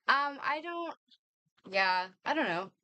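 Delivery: ordinary delivery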